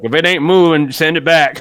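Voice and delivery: loud corny dad punchline; embarrassing dad joke. Retold or imitated. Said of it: "classic dad joke where it's like".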